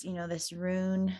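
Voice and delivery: usual speaking voice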